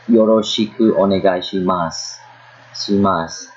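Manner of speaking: shimasu ending on a long s, no u; silent final U. Shown as a demonstration. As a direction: drop the u of shimasu and hold the s. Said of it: "the U is omitted".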